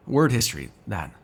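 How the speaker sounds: ordinary talk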